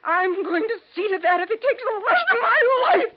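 shouting, voice breaking with rage; furious threat. Acted. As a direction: scream the vow through tears. Vengeful mother threatens Susan.

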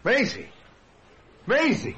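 high-pitched startled cry of her name; shouted name. Acted. Dick shouts on seeing the girl at the door, astonished. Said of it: "Dick shouted".

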